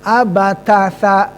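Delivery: "abatasa" slow, th on sa; tongue out for "sa" in "abatasa"; slowed model of tsa. Shown as a demonstration. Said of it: "Sin, bukan".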